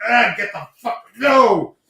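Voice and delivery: high, loud mock panic at a dead mouse; grossed-out yelp. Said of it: "Dead, I'm like".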